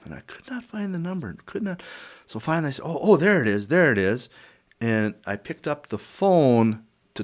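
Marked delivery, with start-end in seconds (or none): 2.8-4.3 relieved, higher exclamation; excited discovery. Retold or imitated. Man finally finding a number in the phone book, excited. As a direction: blurt it as you spot it.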